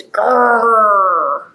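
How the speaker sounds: long rolled playful growl; drawn-out mock growl; dog growl.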